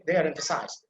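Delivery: ordinary talk, nothing marked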